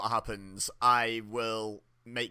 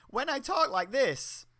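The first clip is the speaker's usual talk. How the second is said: much higher, slow, untrustworthy; insincere high voice; 12 semitones higher, slower than usual. Shown as a demonstration. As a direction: go up high so nobody believes you. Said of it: "you can't trust a damn thing I'm saying".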